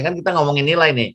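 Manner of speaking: normal talking voice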